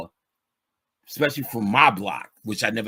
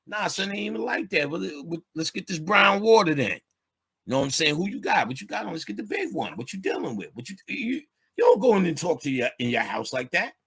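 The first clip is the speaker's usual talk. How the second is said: higher, fast slang chatter with friends; street banter; 6 semitones higher than usual. Retold or imitated. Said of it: "when you go outside".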